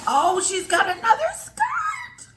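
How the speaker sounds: very high, loud, crying shout of joy; real overwhelmed squeal of delight; near tears. Real, not acted. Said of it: "She's gonna make me cry".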